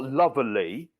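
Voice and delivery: "lovely" as "luvverly", with an R in the middle; mock three-syllable "lovely", luv-er-lee; mispronounced "lovely". Shown as a demonstration. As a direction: put an extra R syllable into "lovely". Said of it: "Why are you rolling it?".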